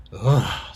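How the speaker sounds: a loud shivering ugh at the snow; cold ugh. Acted. Susan stamping in the snow, a loud cold ugh. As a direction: shudder it out, loud. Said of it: "stamping her feet".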